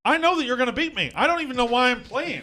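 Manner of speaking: high, loud, hammed-up whining; mock whine. Host whining to his ping pong rival that he'll lose, mock despair. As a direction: whine like a sore loser before the match.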